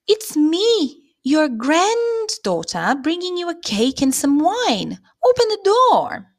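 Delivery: put-on a wolf squeaking to pass as the child; wolf faking the girl.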